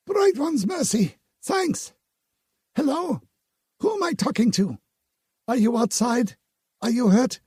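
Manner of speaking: high, relieved woman's voice asking fast questions; woman on the rune relieved to find a survivor. A narrator's reading.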